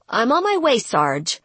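hurried. Acted. Rihanna scrambles to comply.

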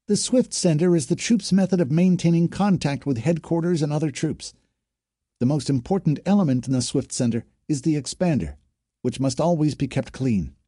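high-pitched drill recitation with a twang; parroting training officer. A narrator's reading. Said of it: "Parroting her training officer".

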